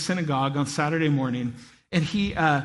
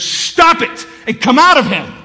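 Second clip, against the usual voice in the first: loud, slow, stern command; Jesus commanding the demon loudly; commanding rebuke; 7 semitones higher, 11 dB louder than usual. Acted. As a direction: cast the demon out with full authority. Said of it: "he goes".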